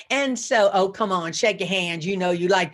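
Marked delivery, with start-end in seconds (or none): none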